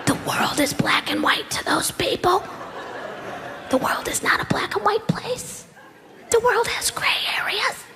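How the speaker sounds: a hushed whisper, like a conscience; whispered inner voice. Retold or imitated. The comic's inner voice warns him about the quiz, whispering. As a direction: whisper it like a secret nagging voice.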